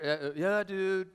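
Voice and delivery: lightly voiced dude-speak; a hint of an unbothered carnie; laid-back ride operator. Ride operator brushing off a worried rider, lightly mimicked dude voice. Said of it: "that's how he talked".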